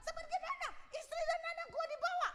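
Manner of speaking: very high-pitched protest; indignant retort. Acted. Man whose wife and children were taken, protesting. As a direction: squeal back in mock outrage. Angry man refuses to calm.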